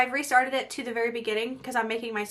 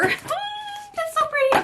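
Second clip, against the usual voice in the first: much higher, slow gushing delight; slower than usual. Real, not acted. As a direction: coo over it, slow and high. Admiring palette rows.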